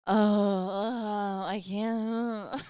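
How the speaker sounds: imitated groaning. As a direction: mimic a partner groaning in pain. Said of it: "you came back and were like".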